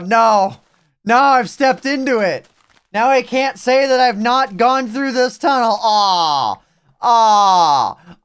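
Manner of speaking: high loud wail of dismay; loud, high, groaning frustration; dismayed outburst. Real, not acted. Caught in trap, dismayed outburst.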